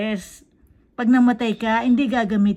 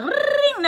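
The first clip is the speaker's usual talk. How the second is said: high squeaky ring, much higher; phone ring imitation. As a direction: mimic a ringing phone.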